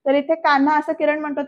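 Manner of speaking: ordinary delivery, nothing marked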